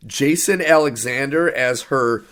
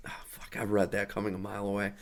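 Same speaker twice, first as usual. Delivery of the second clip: unimpressed; 8 semitones lower, 16 dB quieter, much faster than usual. Retold or imitated. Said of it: "And you're like".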